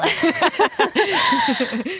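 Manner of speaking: a bright, loud, embarrassed laugh. Real, not acted. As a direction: burst out laughing, caught off guard. Laughs at accent question.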